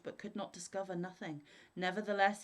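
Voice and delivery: ordinary delivery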